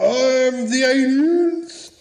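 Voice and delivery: high, very slow put-on alien voice; bad alien voice. Caller replaying the alien message, high and slow alien voice. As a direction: stretch every word like a badly done spaceman. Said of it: "I didn't do that voice very well".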